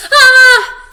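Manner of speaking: very high, very loud shriek. A narrator's reading. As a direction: scream in terror. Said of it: "Stanley shrieked".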